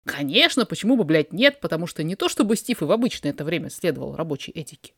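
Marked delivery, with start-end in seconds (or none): none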